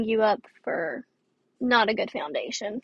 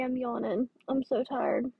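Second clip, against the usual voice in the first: ordinary delivery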